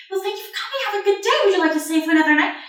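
high, chatty innkeeper patter; cheerful, high-pitched send-off to customers; happy hostess. Acted. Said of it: "just happily chatting to patrons".

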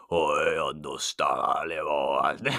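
lightly hammed-up all-knowing mage; mystic wizard. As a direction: draw out a deep wise I understand. Acting the mystic goblin wizard.

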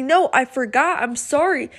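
ordinary delivery

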